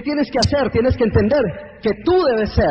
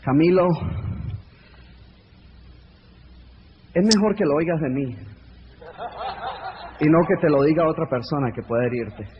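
patronizing friend; 5 semitones lower than usual. Pretend. A friend warning Camilo about his dreams, lower and patronizing. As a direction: talk down gently like a know-it-all friend.

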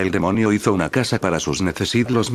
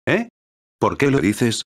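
a puzzled, lightly surprised question; mildly confused eh; 3 semitones higher than usual. Acted. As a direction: ask it a bit baffled. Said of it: "dijo confuso".

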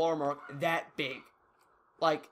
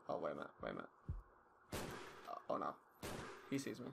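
hushed gamer; 13 semitones lower, 14 dB quieter, faster than usual. Real, not acted. Gamer hushes to viewers as an enemy spots him, very soft. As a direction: drop to a near-whisper, much lower and softer.

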